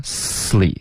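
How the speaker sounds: sly as "slee", s gliding into lee; no gap in sly, hold the s then lee; one-syllable model of sly, s-lee; directly linked. Shown as a demonstration. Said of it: "就直接连发".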